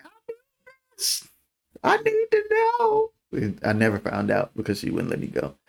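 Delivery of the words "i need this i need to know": 1 mock crying plea. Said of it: "I started crying".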